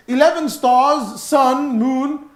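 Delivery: "Eleven stars" slow and loud, then "sun", "moon"; stressing the odd order. Shown as a demonstration. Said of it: "his order is all over the place".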